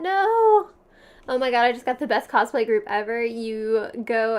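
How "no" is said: high, loud shocked no; shocked shout. Real, not acted. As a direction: cry out in disbelief. Shocked reaction to chat.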